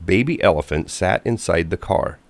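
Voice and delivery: ordinary delivery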